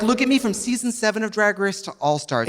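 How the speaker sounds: ordinary talk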